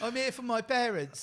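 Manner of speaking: fake British accent. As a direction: play an overdone British rescuer announcing himself. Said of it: "it's my fake British accent that I can do very well".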